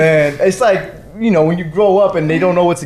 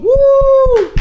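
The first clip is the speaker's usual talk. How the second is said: excited whoop; 4 dB louder than usual. Real, not acted. Hyping up guest.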